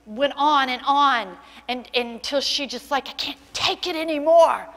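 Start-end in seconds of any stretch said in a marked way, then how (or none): 3.1-4.7 desperate outburst. Retold or imitated. Girl breaks under family abuse.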